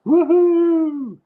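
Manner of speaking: very high, loud woohoo cheer; a wild celebratory woohoo; excited woohoo. Real, not acted. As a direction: whoop it out with joy. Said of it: "I said woohoo".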